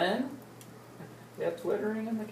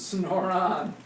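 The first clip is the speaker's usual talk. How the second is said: plain reading voice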